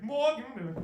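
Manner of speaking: high, loud call down the street; sudden hammed-up German greeting; booming mock greeting. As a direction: shout it to startle passers-by. Greeting strangers to freak them out.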